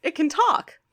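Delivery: high, loud mock amazement; amazed inventor. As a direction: exclaim it high, as if your machine came alive. Voicing Wheeljack amazed by a drone.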